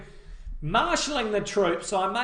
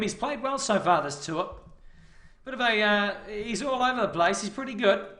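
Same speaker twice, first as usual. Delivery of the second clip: usual speaking voice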